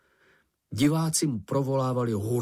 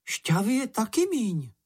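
high-pitched little creature remark; small creature voice; 10 semitones higher than usual. A narrator's reading. Čenich checks the juice bottle, high creature voice. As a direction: pipe up as a small animal.

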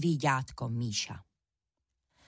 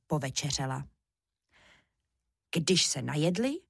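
ordinary delivery

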